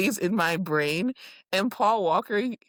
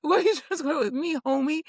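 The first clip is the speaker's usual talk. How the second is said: high, quick mock challenge; Danny's comeback; 7 semitones higher, faster than usual. Podcaster quotes Danny hitting back at Lala, high mock challenge. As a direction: throw it back fast in a raised voice. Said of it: "when Danny hit her back with".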